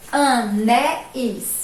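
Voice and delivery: "Anéis" drawn out slowly as a-né-is; plural ending. Shown as a demonstration. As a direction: separate the final is in "Anéis". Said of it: "plural da palavra terminar em is".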